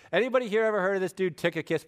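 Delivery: normal talking voice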